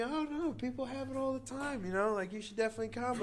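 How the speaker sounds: high-pitched imitation of the woman; breezy persuasion. Woman casually urging him to come.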